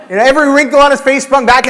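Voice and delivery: ordinary talk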